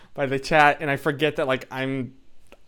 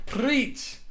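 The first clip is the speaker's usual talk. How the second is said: higher, emphatic agreement; high affirming preach; 9 semitones higher than usual. Real, not acted. Streamer agreeing with Susan, high emphatic cheer. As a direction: cheer the comment on.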